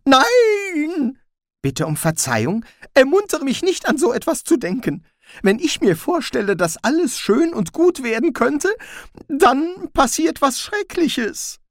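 slowing into dread at the end; panicked Rincewind. A narrator's reading. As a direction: cry out and plead in alarm.

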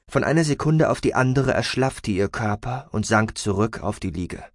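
plain reading voice

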